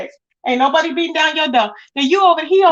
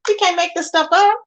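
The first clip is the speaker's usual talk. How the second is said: ordinary delivery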